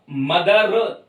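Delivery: मदर with the r fully sounded; मदर, final r spelled out plainly; clear final r. Shown as a demonstration. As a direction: say मदर ending on a distinct rolled-out r. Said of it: "साफ रा नहीं आएगा".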